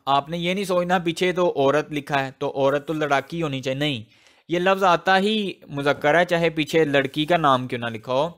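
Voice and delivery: normal talking voice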